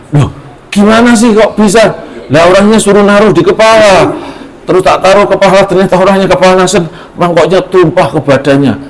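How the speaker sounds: put-on high, flustered boy explaining, fast in places; a scared nephew blurting it out; alarmed child.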